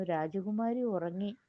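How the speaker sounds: usual speaking voice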